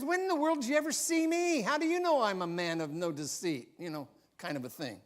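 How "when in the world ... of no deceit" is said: high, quick, baffled questioning; incredulous challenge. Acted. Nathanael questions Jesus on how he knows him, incredulous challenge. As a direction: demand to know, pitch jumping up then easing down.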